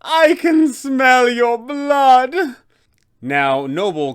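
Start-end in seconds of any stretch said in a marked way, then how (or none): 0.0-2.3 slow, high, loud hungry shriek; hungry vampire cry. Acted. Vampire spawn crawls toward the adventurers, slow hungry shriek. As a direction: draw it out like a predator scenting prey. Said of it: "he can cry out".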